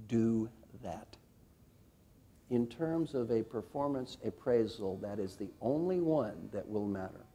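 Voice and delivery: hushed guided visualization; guided reflection. Acted. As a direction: lead them inward gently.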